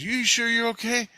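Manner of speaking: high-pitched, questioning imitation; double-check in her high voice; mimicked concerned woman.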